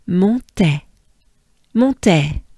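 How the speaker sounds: Montais, nasal mon, clear TEH; French Montais. Shown as a demonstration. Teacher says Montais for students to copy. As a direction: model Montais cleanly. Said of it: "Good breath with me, avec moi".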